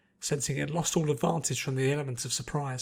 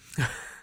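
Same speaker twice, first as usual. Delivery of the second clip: chuckle. A narrator's reading. Pinkie falls, chuckle.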